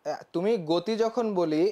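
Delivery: ordinary delivery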